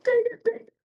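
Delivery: squeaky high panicked dang it; panicked squeak. Retold or imitated. Traveler panicking as border officers hold her passport, squeaky high wail. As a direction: voice jumps way up in panic.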